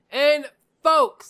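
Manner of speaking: big loud call out; loud hype. Real, not acted. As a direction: belt it to the viewers. Hyped greeting to viewers.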